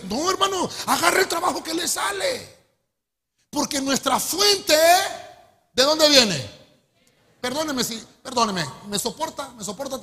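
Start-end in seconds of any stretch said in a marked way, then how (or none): 0.0-2.6 high, loud urgent plea; preacher pleading loudly with brothers; preaching shout. Real, not acted. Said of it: "preacher urging congregation". 3.5-6.6 slow, very loud rhetorical question; sermon climax shouted; preaching emphasis. Real, not acted. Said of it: "preaching climax".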